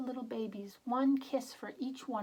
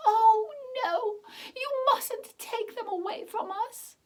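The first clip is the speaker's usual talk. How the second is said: very high, loud crying plea; high-pitched wail of a heartbroken old wife; old woman's desperate cry; 11 dB louder than usual. Acted. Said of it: "the old woman exclaimed".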